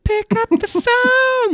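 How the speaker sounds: very high, slow mock hotline voice breaking into a laugh; phone sex commercial. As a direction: stretch it out sky-high, laughing mid-line. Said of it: "that phone sex commercial".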